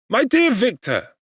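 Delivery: high alarmed cry of a worried friend; Clerval crying out. A narrator's reading. Clerval cries out to feverish Victor, high and alarmed. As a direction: cry out his name in alarm. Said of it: "cried he".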